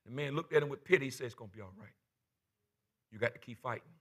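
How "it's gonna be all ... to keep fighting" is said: gentle. Retold or imitated. Kind man comforting Bobby.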